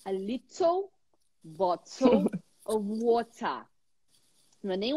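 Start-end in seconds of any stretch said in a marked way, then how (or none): 0.0-3.7 crisp British English, "little" and "water" with clear t; British accent. Shown as a demonstration. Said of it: "Como que um britânico vai falar".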